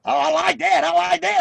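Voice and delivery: high, delighted retold approval; mock-thrilled promoter; pleased boss.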